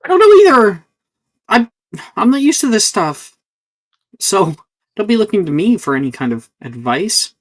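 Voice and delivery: high-pitched, flustered protest; defensive student out of their depth. Acted. Juniper flustered, refusing to give advice.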